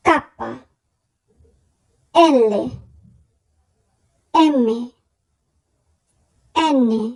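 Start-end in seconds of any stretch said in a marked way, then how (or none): none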